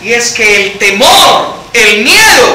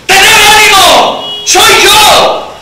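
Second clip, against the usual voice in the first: high, slow emphatic shout; calling out; 9 semitones higher, 4 dB louder, much slower than usual. Acted. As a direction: call across the water to reassure them. Jesús llama a los discípulos. (llamado fuerte y alargado)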